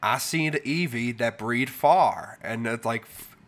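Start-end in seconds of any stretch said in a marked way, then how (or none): 0.0-2.4 slow, thick Southern drawl; Southern accent. Retold or imitated. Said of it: "had a really thick Southern accent".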